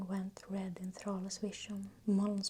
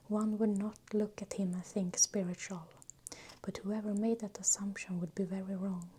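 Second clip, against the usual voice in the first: ordinary delivery, nothing marked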